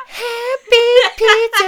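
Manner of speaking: slow, very high falsetto singing. Shown as a demonstration. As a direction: belt it in a lovely lady falsetto. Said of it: "but in, like, a falsetto or".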